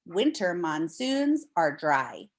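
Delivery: normal talking voice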